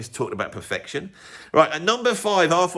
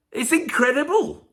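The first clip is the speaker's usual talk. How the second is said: high, slow, drawn-out amazement; incredulous; 11 semitones higher than usual. Real, not acted. Vlogger defending the song to viewers, high incredulous. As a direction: sell how wrong they are with disbelief.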